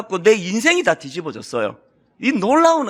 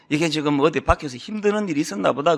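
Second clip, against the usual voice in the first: softer, lower and understanding; 6 semitones lower, 4 dB quieter than usual. Retold or imitated. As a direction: think it quietly, with sympathy. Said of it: "논리로 다가가는 게 아니고". (아내가 지친 남편을 이해하며 조용히)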